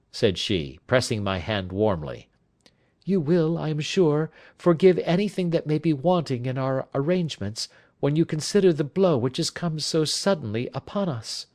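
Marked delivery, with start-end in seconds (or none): 3.1-11.4 high-pitched lady's voice, politely apologising; anxious wife, apologetic. Acted. Wife apologises to guest after the sudden blow, high apologetic voice. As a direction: ask indulgence in a raised lady's voice.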